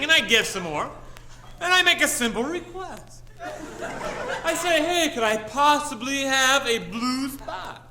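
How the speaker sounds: high-pitched indignant rant, building; hammed-up showbiz tantrum in a high voice; mock angry. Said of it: "but I am angry".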